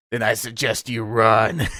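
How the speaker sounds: slow, low deadpan threat; a hint of a furious father; dad warning his son before the chase, slow and low; stern dad threat. Angry father about to chase son.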